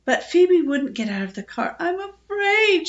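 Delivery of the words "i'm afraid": put-on high, loud, drawn-out child's wail; whining scared little girl; frightened wail. A narrator's reading. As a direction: wail it slowly, high with fear. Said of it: "she wailed to her daddy".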